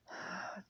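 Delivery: short, soft breathy sigh; acted sigh. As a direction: sigh quietly in character. Voicing a classmate in story.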